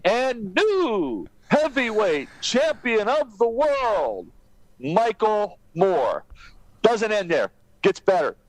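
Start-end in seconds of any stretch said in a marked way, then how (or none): 0.0-6.2 slow, stretched ring-announcer call. Retold or imitated. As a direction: draw it out like announcing a champion.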